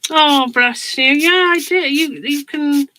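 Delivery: loud and high, brush still in mouth; mouth full. Real, not acted. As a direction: talk past a paintbrush clenched in teeth. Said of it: "let me get this paintbrush out of my mouth".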